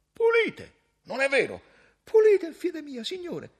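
very high, loud protesting voice; barber's eager reply. Acted. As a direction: insist earnestly to the master. Barber answers timidly.

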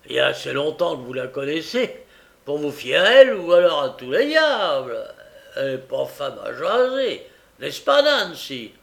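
Sikes demanding. A narrator's reading. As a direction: press her hard like a rough thief. Sikes voiced, gruff demand.

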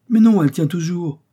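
high, loud protest; excited contradiction. A narrator's reading. Joe corrects his companion about the anchor, excited contradiction.

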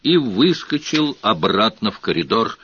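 ordinary delivery

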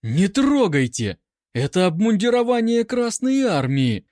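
high, loud protesting squeal; shrieking. A narrator's reading. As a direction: squeal out an indignant objection. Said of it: "Анка взвизгнула".

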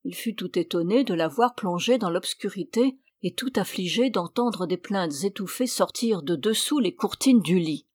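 ordinary delivery, nothing marked

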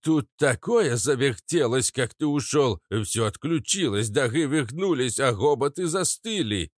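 lilting, animated character voice; Papasha Katz. A narrator's reading. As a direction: gush like a singing nightingale. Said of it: "Заливаясь соловьём, пел папаша Кац".

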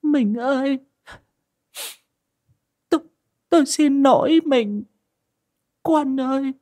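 high, tearful woman's voice begging forgiveness; a kneeling wife, voice choked with tears; high-pitched, sobbing apology to husband and son. Acted. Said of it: "quỳ thụp xuống nghẹn ngào".

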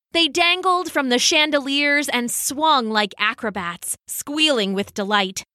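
plain reading voice

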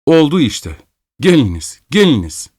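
mild narrated shout; urgent calling. A narrator's reading. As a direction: urge someone over in quick bursts. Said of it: "diye bağırdı".

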